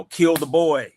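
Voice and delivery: ordinary delivery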